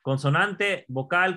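usual speaking voice

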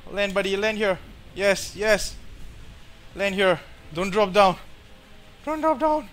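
real desperate urging; frantic pleading. Real, not acted. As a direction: plead with the creature to hold on. Pleading with his tame.

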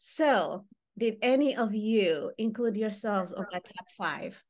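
normal talking voice

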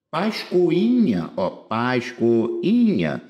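Pascoinha spelled out slowly with the stress on i; slow teaching demo of a word; syllable by syllable. Shown as a demonstration. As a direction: drag out pascoinha so the stressed i is heard. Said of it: "o I seguido de N H".

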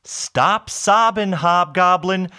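loud, high, drawn-out plea; storybook hero calling over a wailer; exasperated command. A narrator's reading. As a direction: call out slowly to stop the crying. Bob scolds the sobbing creature.

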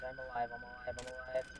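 relieved murmur. Real, not acted. Relieved murmur after scare.

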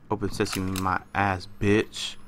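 soft, low muttering; muttered whisper. Real, not acted. Gamer hiding, muttering quietly at a locked door. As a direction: grumble under the breath at the door.

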